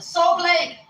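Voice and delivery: high, insistent "Soap Lake"; emphatic answer. Retold or imitated. Answering where she is from.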